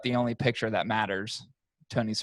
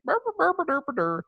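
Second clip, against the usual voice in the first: high, silly burr sounds like a big bird; bird call imitation. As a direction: mimic a strutting turkey, way up high. Mimics the turkey vulture strolling.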